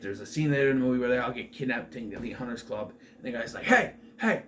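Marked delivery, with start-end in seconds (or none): 3.6-4.4 high, loud imitated shout for attention; calling out. Kidnapped man calls out at the club, raised imitated shout. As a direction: call sharply to get someone's attention, acted. Retold captive calling out to captor.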